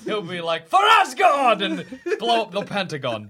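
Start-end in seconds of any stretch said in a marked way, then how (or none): 0.7-1.6 loud warrior war cry; viking battle cry. Pretend. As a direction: bellow it like a Norse god. Thor battle cry again.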